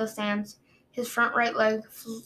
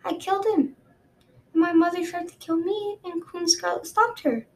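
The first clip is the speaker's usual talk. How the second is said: put-on young dragon girl voice; 8 semitones higher than usual. Peril voiced, higher than narration.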